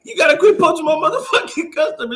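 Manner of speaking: high, fast retold complaint; mock angry confrontation. Acted argument over poaching a customer.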